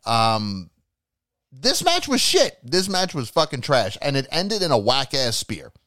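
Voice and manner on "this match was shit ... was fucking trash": high-pitched, emphatic complaint; real fan frustration, voice raised high; exasperated verdict. Real, not acted. Vents disappointment at the match.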